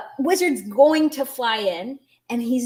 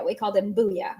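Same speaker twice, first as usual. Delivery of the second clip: ordinary delivery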